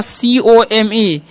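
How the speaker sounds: slow letter by letter spelling, C O M E; reading the letters aloud slowly to the class. Shown as a demonstration. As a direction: spell out the letters of "सीओएमई" one at a time. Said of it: "क्या लिखा हुआ है?".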